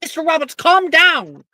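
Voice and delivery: high, loud scolding professor; shouting professor. Acted. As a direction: yell at the student to stop. Professor NPC yells again.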